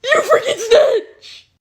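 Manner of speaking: high, strained mock shout; hammed-up, high-pitched loud accusation; outraged classmates. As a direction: imitate a class yelling in outrage. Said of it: "everyone's like".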